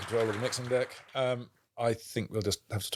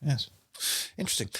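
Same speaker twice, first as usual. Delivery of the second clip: ordinary talk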